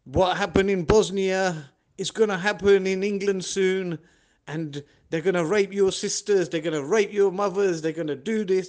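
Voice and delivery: loud, high, hammering warning speech; imitated alarmist preacher. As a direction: mimic a preacher rousing the congregation with dire warnings. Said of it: "And the way he was talking".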